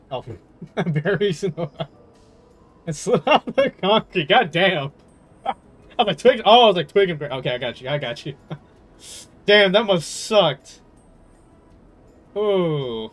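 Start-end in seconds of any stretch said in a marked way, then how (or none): none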